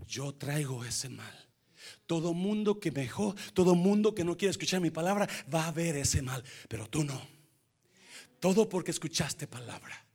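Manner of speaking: tender divine voice. Acted. God speaking to the king.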